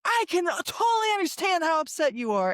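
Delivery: high-pitched, loud shout of understanding; demonstrative shouted validation; matching someone's yelling. Pretend. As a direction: yell back empathy at the upset person's volume. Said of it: "meeting them with that same level of volume".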